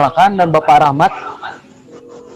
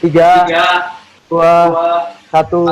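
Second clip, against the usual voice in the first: counting down to go; slower than usual. Real, not acted. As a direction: count each number firmly, pausing between. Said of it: "Saya akan hitung mundur". (hitung mundur dengan lantang)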